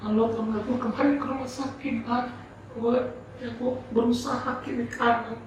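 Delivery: high, strained imitation of crying; acted weepy plea, pitched far up; tearful retelling. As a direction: voice someone pouring out bad news through tears. Retells tearful confession of illness.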